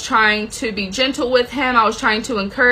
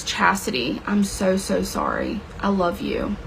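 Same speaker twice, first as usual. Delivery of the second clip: tender comfort; 4 semitones lower, 6 dB quieter than usual. Retold or imitated. Daughter hugs and comforts her.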